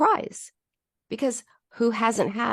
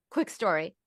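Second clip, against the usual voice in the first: usual speaking voice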